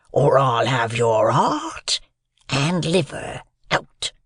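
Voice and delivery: very slow, rasping threat; menacing convict. A narrator's reading. Convict threatens the boy's life, menacing. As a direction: drag the threat out word by word.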